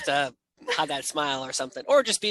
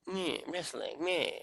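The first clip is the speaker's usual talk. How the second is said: dismissive meh; 9 dB quieter, slower than usual. Retold or imitated. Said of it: "people will, like".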